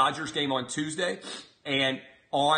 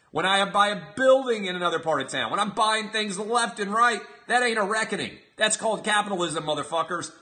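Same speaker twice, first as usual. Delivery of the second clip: ordinary delivery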